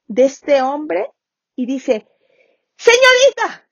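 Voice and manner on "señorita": high, loud call for the nurse; shouted call. Acted. Patient yelling for the nurse from his bed, high and loud. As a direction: yell down the hall for help. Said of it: "la enfermera oye un grito enorme".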